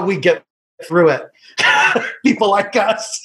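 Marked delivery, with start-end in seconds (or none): 1.6-2.2 kind gentle laugh. Real, not acted. Laughing at own crying story.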